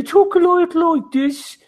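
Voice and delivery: high, slow Birmingham accent demo; Brummie accent. Shown as a demonstration. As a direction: put on a thick Brummie accent, pitched well up. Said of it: "can we have a sample?".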